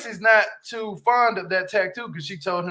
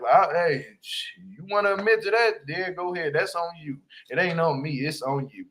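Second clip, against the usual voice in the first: usual speaking voice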